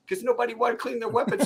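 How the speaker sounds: usual speaking voice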